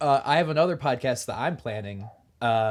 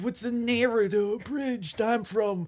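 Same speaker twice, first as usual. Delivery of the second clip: higher-pitched silly character voice; put-on new voice; 4 semitones higher than usual. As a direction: play a goofy character, pitched up. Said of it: "I really enjoy this new voice".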